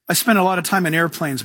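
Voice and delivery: ordinary talk, nothing marked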